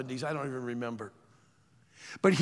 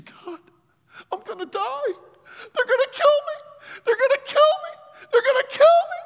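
high, sobbing cry, building louder; terrified crying; 8 dB louder than usual. Retold or imitated. Preacher voicing Alberto trapped in the culvert, crying out in fear. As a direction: cry out in terror, voice breaking high.

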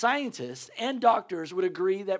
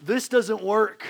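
slow, louder mock teacher; teacher's retort; 2 semitones higher, 6 dB louder, much slower than usual. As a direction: drag it out like a fed-up teacher. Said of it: "And he goes".

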